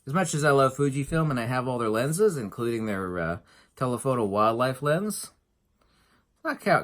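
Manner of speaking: ordinary delivery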